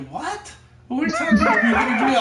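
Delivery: high-pitched, laughing disbelief; mock incredulity. Answering the girl who noticed his drinking, mock incredulity. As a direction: squeak the question up, then protest loudly. Retold protest to woman on plane.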